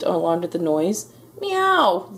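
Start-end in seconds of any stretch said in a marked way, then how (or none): 1.4-2.1 very high cat cry; yelled meow. A narrator's reading. Cora alarmed by Baby Ghost, yowling. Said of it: "yelled Cora".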